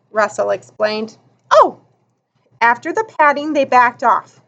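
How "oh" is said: put-on high, loud sudden "oh" of a kid; a bright startled gasp of understanding; surprised oh. Little boy realizing why they pat the stranger, high surprised exclamation.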